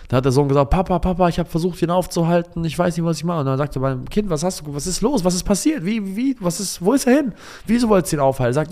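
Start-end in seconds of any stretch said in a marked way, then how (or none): 0.7-3.4 put-on high, urgent little boy calling his dad; young son's voice. Son runs to his father in alarm, high child voice. 4.3-8.5 put-on very high, rapid child's questions; a little boy firing worried questions, voice way up; agitated child. Son pressing his father about the beggar, high agitated child.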